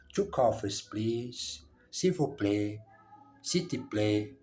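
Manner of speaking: ordinary delivery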